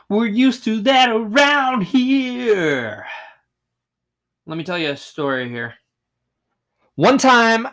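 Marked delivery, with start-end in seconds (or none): 0.1-3.1 slow, high, put-on voice, teasing himself; mock deadpan aside. Joking to viewers about his own rambling, slow mock drawl. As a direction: drag it out like a wry local.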